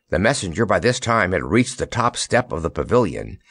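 ordinary delivery

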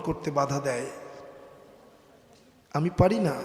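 soft, halting, regretful uncle; dying refusal. Acted. Dying Abu Talib tells his nephew he cannot convert, soft resignation.

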